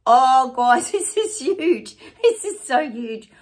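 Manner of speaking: high, laughing, overjoyed exclamation; real excitement. Real, not acted. Vlogger marvelling at the crowd size, thrilled. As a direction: burst out with delight, slowing on the words. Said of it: "It's so exciting".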